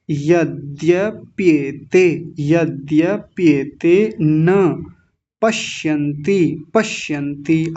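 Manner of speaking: यद्यप्येते slowly, every syllable distinct; slow Sanskrit recitation for learners. Shown as a demonstration. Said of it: "ऐसे मिलाएंगे".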